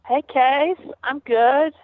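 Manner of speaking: normal talking voice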